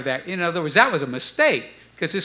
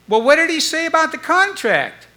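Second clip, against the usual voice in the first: high, louder impatient mock voice; impatient asking; 12 semitones higher, 5 dB louder than usual. Bandmates pushing Stu about the contract, high and impatient. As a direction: push hard, they're dying to know.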